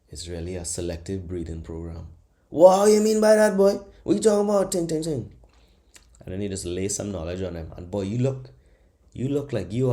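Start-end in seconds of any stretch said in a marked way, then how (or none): 2.5-5.3 high, loud amazed voice of a pub listener; shocked drinker. Retold or imitated. Pub listener stunned by the breeding theory, high and loud. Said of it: "imagined listener reacts".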